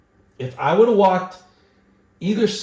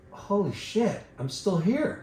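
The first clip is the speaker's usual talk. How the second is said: higher, mock-stunned realization; retold shocked realization; 6 semitones higher, 4 dB quieter than usual. Man retelling waking up at the cliff's foot, shocked realization. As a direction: relive waking up stunned, pitched up.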